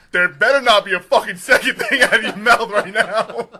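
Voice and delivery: mock angry dad. Dad warning son from front seat, mock furious shout. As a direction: bellow it as a warning to a mouthy kid. Father scolding son in story.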